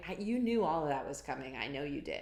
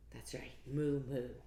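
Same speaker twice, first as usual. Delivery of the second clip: plain reading voice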